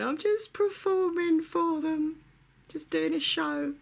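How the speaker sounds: put-on high, slow, drawn-out child's wail; mock crying. Child pleading with angry dad, exaggerated tearful wail. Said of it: "crying and crying and crying and going".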